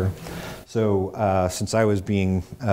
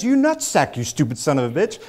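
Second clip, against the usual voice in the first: insulting shout; 7 semitones higher than usual. Retold or imitated. Guard insults him.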